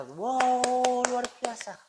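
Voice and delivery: high, loud delighted exclamation; impressed audience member gasping with delight; excited wow. Retold or imitated. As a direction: cheer the speaker on. Audience reacts with praise.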